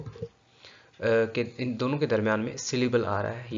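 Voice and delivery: ordinary talk, nothing marked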